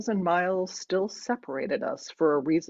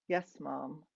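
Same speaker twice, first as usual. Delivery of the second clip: soft, low-key assent; resigned daughter; 4 semitones lower, 10 dB quieter than usual. A narrator's reading. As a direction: give in quietly to mom. Daughter giving in to mother.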